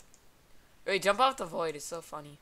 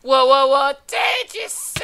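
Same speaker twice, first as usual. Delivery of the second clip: startled shout; 5 semitones higher, 14 dB louder, slower than usual. Real, not acted. Startled by a sound in game.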